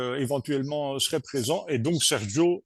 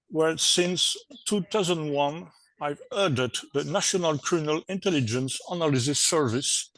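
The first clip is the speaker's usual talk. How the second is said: ordinary delivery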